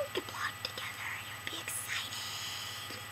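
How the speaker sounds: breathy whispered echo. Acted. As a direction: whisper it back like an afterthought. Echo whispered after bright take.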